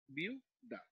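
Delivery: "viuda" repeated as viu, pause, da; split into syllables. Shown as a demonstration. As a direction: say "viuda" in two separate beats, VIU-DA. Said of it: "en una sola sílaba las dos vocales".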